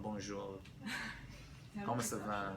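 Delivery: low, soft French accent; smooth-talk it in French; croon-free, softly suave French delivery. Shown as a demonstration. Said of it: "you sound suave".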